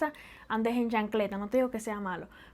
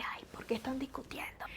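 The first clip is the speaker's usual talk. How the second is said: whispered aside, much softer; hushed onlooker; 14 dB quieter than usual. Acted. Onlooker wondering why Cubans argue, whispered. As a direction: murmur it under the breath in a whisper. Imagined onlooker remarks on arguing.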